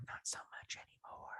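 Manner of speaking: barely audible rueful whisper; hushed, voiceless afterthought; whispered aside. Real, not acted. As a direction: confess it under your breath. Rueful aside about herself.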